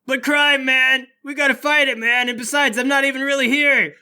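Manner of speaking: high, loud pleading; Bart protesting loudly. Acted. Bart argues against fleeing.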